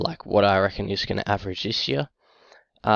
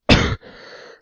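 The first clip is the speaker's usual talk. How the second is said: harsh, real chesty cough; coughing fit; 11 dB louder than usual. Real, not acted. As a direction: cough hard into the mic. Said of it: "sorry about the end there".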